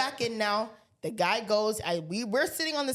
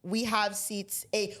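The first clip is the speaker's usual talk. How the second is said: usual speaking voice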